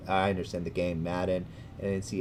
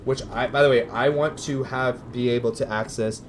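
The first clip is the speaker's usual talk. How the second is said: normal talking voice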